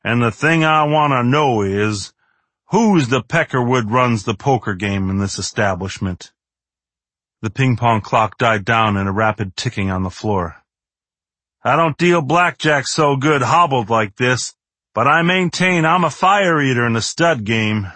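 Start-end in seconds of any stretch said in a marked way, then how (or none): 0.0-6.3 a swaggering movie cowboy; cowboy drawl. Acted. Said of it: "in his drawling cowboy actor's voice". 11.6-17.9 higher, boastful cowboy drawl. Acted. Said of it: "in his drawling cowboy actor's voice".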